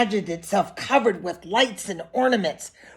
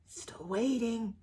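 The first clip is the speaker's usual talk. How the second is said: hushed aside; 13 dB quieter, slower than usual. Acted. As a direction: lean in and murmur it to the kids.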